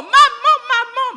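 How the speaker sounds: put-on high, very loud child's cry; child screaming for mum. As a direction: yell for mum in delight. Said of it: "Arrête de crier".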